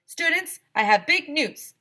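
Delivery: high, loud, slow announcement; grand principal voice; principal announcing. Acted. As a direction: proclaim big news.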